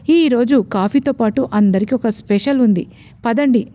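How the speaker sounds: plain reading voice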